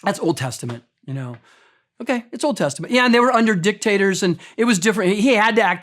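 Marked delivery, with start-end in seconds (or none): 0.0-1.4 dismissive objection. Retold or imitated. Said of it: "some of you are like".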